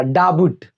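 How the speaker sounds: "डाबुट" with the silent b wrongly sounded; childhood misreading. Shown as a demonstration. Teacher showing students a childhood mistake, misreading "डाबुट" with a b. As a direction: say "डाबुट" as da-BUT, b fully voiced. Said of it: "मैं बचपन में इसको क्या पढ़ता था".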